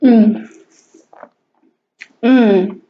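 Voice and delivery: appreciative mm of tasting; savoring delicious food. Real, not acted. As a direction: hum with pleasure at the taste. Tasting first bite.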